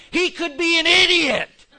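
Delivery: loud, high exclamation; exasperated outburst. Real, not acted. Preacher debunking the admired British lecturer, exasperated outburst. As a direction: burst out in disbelief, voice jumping up. Punchline bursting the mock admiration.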